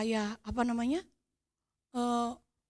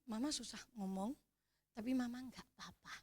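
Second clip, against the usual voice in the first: much softer, breathless reassurance; out of breath; 14 dB quieter than usual. Retold or imitated. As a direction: gasp it out gently, barely able to speak. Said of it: "Saya sudah sengal-sengal loh itu".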